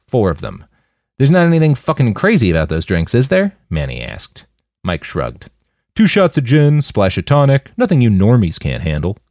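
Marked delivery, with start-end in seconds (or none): 1.2-3.5 higher, wary rising question; half joking suspicion; suspicious question. Acted. 5.9-9.2 louder, swaggering bartender voice; cocky. Acted. Mike's cocky reply.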